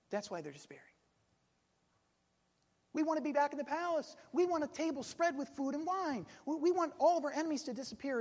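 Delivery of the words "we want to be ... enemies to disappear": quick, higher-pitched list of complaints; demanding. Retold or imitated. Companions wanting the palace back, demanding. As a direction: reel off demands in a raised pitch.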